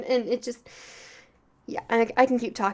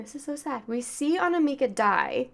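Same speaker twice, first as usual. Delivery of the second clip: tearful; 4 semitones higher, 4 dB quieter than usual. Real, not acted. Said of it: "I'm gonna start crying".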